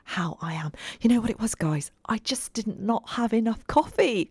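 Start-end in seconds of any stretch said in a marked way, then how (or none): 1.0-1.9 whispering. Real, not acted. Confiding aside to listeners. 2.1-4.3 whispering. Real, not acted. Whispered first take.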